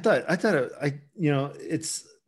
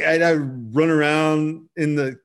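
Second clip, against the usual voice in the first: ordinary talk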